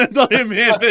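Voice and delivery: high, loud, laughing through the words. Real, not acted. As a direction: crack up mid-sentence.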